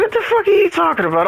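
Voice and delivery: high, fast imitation of his own outrage; mock outrage. [name] retells asking girlfriend about his controller, fast mock outrage. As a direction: fire it off in fake disbelief.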